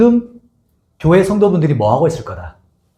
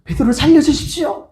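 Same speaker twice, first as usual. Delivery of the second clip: much higher pleading cry; fervent prayer; 11 semitones higher than usual. Retold or imitated. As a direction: plead to God for Peter's life. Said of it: "뜨겁게 막 기도하고 있어요".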